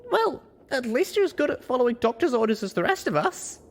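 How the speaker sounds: teasing a friend through a chuckle; high, light, wry teasing; chuckling. A narrator's reading. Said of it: "I chuckled".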